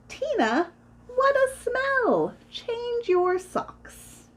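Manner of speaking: put-on high, stretched-out kids' whine; high, drawn-out schoolyard protest; disgusted complaint.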